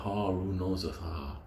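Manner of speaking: hushed, sly mock innocence, fading to a breath; mock innocent hush. Chinese people mocked as feigning innocence, softly. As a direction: drop it soft, playing dumb. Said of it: "they be like".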